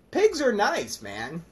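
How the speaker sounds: ordinary delivery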